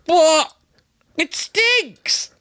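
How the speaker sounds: very high, mock-female outburst; imitated woman. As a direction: squeal it out as the disgusted woman. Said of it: "she was just like".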